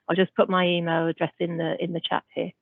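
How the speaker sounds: ordinary talk, nothing marked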